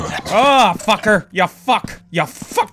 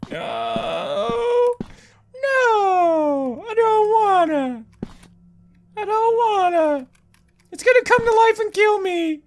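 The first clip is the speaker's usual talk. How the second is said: whiny dread; 14 semitones higher, slower than usual. Real, not acted. Dreading putting the head on.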